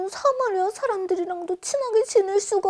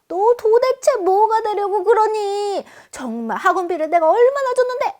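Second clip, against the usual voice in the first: scolding parent; 5 semitones higher, 4 dB louder than usual. Retold or imitated. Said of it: "막 이러면은 더 쪼그라들죠".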